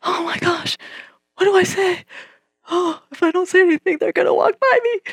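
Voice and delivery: high, fast panicky squeak; freaking out as they come closer; rising panic. Retold or imitated.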